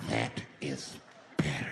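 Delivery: low slow whisper, unsettling; deep whispered menace. Retold or imitated. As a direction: breathe it low like a creep. Deep creepy repeat.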